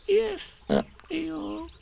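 very high, tiny sniffly voice; Piglet, tearful. A narrator's reading. Piglet admitting the loss to Eeyore, high and tearful. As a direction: squeak it out haltingly. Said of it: "sniffing a little".

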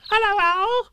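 very high squeak; squeaky Roo. Acted. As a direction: squeak it out, tiny and eager. Roo's squeaky voice.

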